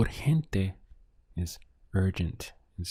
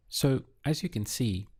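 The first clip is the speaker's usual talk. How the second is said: usual speaking voice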